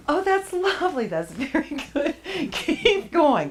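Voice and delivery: high-pitched praise breaking into giggles; real laughter bubbling through encouragement; laughing. Real, not acted. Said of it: "You're not supposed to laugh".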